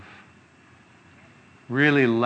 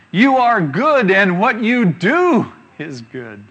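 slow, higher, louder reading of the verse; scripture reading; 6 semitones higher, 5 dB louder, slower than usual. Acted. Preacher reads a psalm about the Lord, slow and lifted. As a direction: lift the praise line high and slow.

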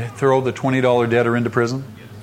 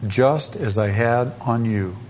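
"just as I had on you" slow and measured, lower; deliberate, spaced-out "just as I had on you"; pointed emphasis; 4 semitones lower, 3 dB quieter, slower than usual. Shown as a demonstration. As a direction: weigh out each word of "just as I had on you". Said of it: "Notice these next words".

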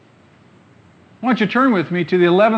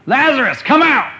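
higher, louder command, "Lazarus" then "come out!"; loud call; 7 semitones higher, 7 dB louder than usual. Acted. Jesus calling Lazarus from the tomb, loud and high. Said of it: "Jesus called out in a loud voice".

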